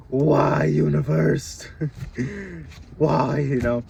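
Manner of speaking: loud, high, laughing disbelief; exasperated outcry. Real, not acted. Vlogger yelling at the universe about snake signs, laughing. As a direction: throw the question up at the sky.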